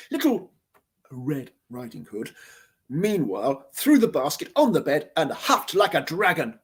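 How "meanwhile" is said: usual speaking voice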